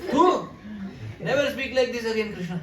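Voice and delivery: a mother shaking her son awake; scolding mother. Acted. Mother Yashoda scolds the sleep-talking Krishna in a high voice.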